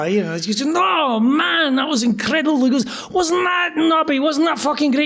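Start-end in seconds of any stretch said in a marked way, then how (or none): 0.7-2.6 Scottish rocker insisting. Retold or imitated. Nazareth guy insisting, praising. 3.1-5.1 higher Scottish brogue, enthusing; Scottish accent. Retold or imitated. Nazareth singer raving to [name], in Scottish accent. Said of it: "thick Scottish accents".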